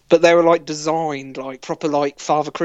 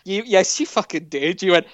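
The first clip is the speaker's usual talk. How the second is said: ordinary delivery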